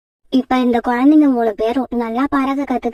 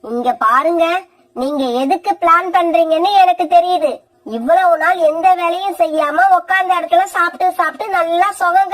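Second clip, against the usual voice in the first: a lightly voiced scornful young wife; 5 semitones higher than usual. Kori ordering her mother-in-law out, scornful. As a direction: brush her off with cold scorn.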